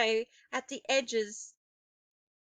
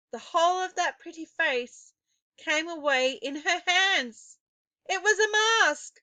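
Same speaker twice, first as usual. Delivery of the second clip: rising dramatic reveal, higher and louder; building reveal; 7 semitones higher, 5 dB louder than usual. A narrator's reading. Climactic reveal of the mask.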